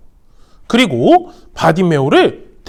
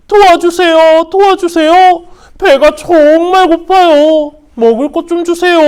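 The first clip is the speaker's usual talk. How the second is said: begging for help; 9 semitones higher, 6 dB louder than usual. Acted. Blind Bartimaeus begging passersby for food, high pleading. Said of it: "사람들에게 도움을 구했어요".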